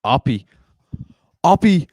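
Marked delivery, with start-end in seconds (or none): 1.4-1.9 name yelled much higher and louder; excited shout. Real, not acted. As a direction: shout his name as he breaks through. Rising excitement at breakaway run.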